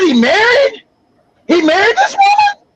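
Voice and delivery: very high, disbelieving question; incredulous. Retold or imitated. Son learns from mom of secret marriage, incredulous. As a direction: pitch leaps up in retold shock.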